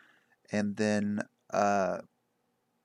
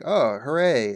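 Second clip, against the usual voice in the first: mock cheer; 5 semitones higher, 10 dB louder, slower than usual. Retold party cheering after the rescue.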